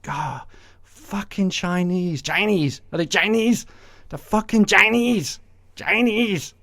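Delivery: hammed-up Trump griping about China; cursing Trump. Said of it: "He's just".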